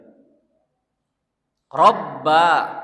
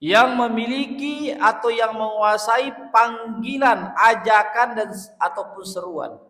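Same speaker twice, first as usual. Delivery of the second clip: high, slow, loud invocation; impassioned preaching; 7 semitones higher, 3 dB louder, slower than usual. Acted. Preacher exalting God before the congregation, high and passionate. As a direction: lift the voice in fervent praise.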